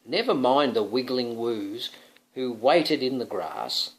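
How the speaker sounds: ordinary delivery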